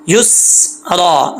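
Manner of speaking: युसरा slowly with held s and long aa; no qalqala. Shown as a demonstration. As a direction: rest on the "स" of युसरा without bouncing. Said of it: "सीन में कलकला नहीं करना". (युसरा में सीन बिना कलकला)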